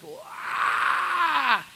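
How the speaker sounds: high mock baby cry; baby wail. Pastor imitating his screaming baby son, high wail.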